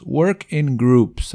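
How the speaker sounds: slow teacher demo; slow model reading. Shown as a demonstration. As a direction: Work in Groups stretched out slowly. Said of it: "ahí está el U".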